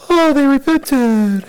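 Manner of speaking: high, slow, syrupy coo; sweetly mocking, drawn-out gushing; mock-tender God. Preacher acting out God moved by Nineveh's repentance, mock-tender. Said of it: "God's all".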